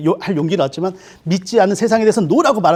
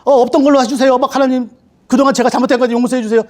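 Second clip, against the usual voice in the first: higher, louder mock begging; pleading to God; 6 semitones higher, 4 dB louder than usual. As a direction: beg God to cancel the ticket. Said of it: "이것 때문에".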